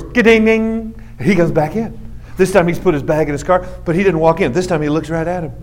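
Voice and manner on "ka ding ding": door chime. Retold or imitated. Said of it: "the door goes".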